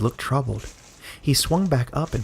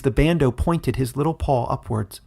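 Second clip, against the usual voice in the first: ordinary delivery, nothing marked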